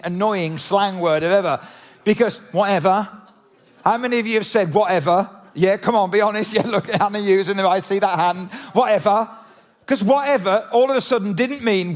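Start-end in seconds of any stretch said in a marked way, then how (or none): 2.5-3.1 camp, sassy "whatever" lifting at the end. Acted. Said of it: "that kind of voice there, that accent, going all camp". 8.7-9.3 camp, sassy "whatever" lifting at the end. Acted. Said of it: "that kind of voice there, that accent".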